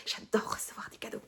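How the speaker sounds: soft playful whisper, almost no voice; whispered aside. Real, not acted. Admitting to her viewers she loves gifts, in a whisper. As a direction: confide it in a hushed whisper like a little secret.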